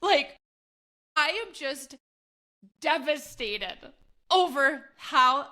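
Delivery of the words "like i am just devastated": ordinary talk, nothing marked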